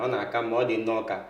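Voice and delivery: ordinary talk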